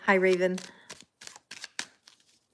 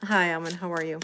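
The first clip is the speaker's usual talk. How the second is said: ordinary talk, nothing marked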